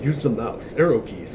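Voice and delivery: normal talking voice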